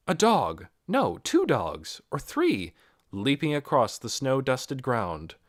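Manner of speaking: ordinary delivery, nothing marked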